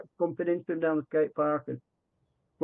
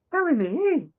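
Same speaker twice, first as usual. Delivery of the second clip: much higher, firm nudge from the wife; insistent wife; 11 semitones higher than usual. Retold or imitated. Wife in the car urging him to say yes, high and insistent. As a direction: push him, pitched high and sure.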